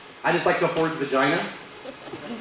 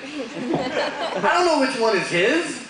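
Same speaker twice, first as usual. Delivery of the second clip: laughing; 7 semitones higher, slower than usual. Real, not acted. Laughing punchline delivery.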